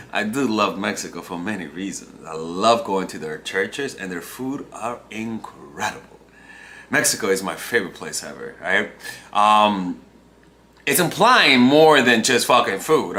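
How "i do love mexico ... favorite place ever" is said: low, soft, insincere praise; saying the opposite. Shown as a demonstration. Answering why he likes Mexico, words belied by the tone. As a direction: praise it while implying you mean otherwise. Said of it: "say the opposite with your body language".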